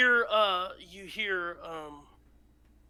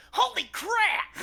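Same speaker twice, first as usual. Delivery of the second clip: put-on high startled child exclamation; little boy's astonished outburst; shocked kid; 4 dB louder, slower than usual. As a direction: gasp it out like an amazed boy. Boy sees the ball blast off.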